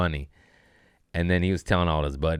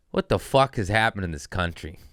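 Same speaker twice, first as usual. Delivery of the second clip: usual speaking voice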